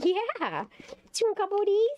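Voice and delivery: high offering voice. Real, not acted. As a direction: coax the cat with treats, high. Offering treats to cat.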